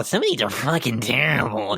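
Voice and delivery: ordinary talk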